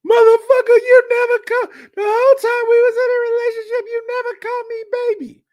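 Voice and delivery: high, loud, furious screaming as the ex; shrieking ex-girlfriend, very high and loud; furious yell. Retold or imitated. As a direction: scream it at him like she's lost it. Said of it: "Tabitha flipped the fuck out".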